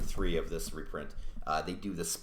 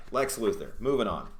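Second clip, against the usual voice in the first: ordinary talk, nothing marked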